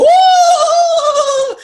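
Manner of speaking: high, loud drawn-out "ooh"; mock islander cheer; crowd whoop. Islanders cheering in retold scene.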